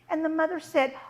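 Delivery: usual speaking voice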